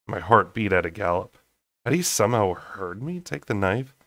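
ordinary delivery, nothing marked

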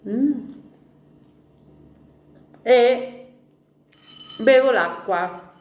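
speaking between bites; talking while chewing. Real, not acted. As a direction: mouth partly full of food. Seen 0: fingers at mouth with pizza, jaw working while speaking.

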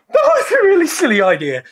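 high loud laugh through words; laughing shriek. Real, not acted. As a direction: laugh it out, still in shock. Laughing after icy plunge.

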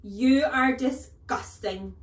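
loud, high, slow insult; outraged headmistress's drawn-out shout; bellowed disgust. A narrator's reading. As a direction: bellow each word in revulsion. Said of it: "the Trunchbull bellowed".

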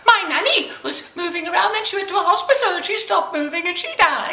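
put-on very high, insistent little-kid voice; high-pitched child's matter-of-fact tale; mock child voice. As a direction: protest like a seven-year-old proving a point. Seven-year-old child telling of nanny.

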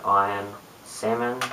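ordinary talk

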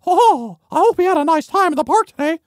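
very high, quick cartoon falsetto; cheery cartoon greeting. Cheery cartoon figure greets someone.

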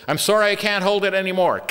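high-pitched giving-up cry, acted; mock straining plea. Helper on the stairs losing hold of heavy furniture, acted strained plea. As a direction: call out as if losing your grip.

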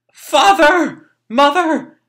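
acted horrified cry. Boy finds father and mother dead, horrified cry. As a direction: call out to parents in horror.

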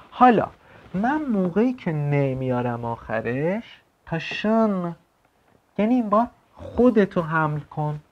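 ordinary talk, nothing marked

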